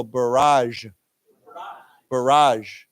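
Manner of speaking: BARRAGE with the weight on RAGE; stress on second syllable. Shown as a demonstration. Teacher models the word for students, second syllable stressed. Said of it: "accent is on the second syllable".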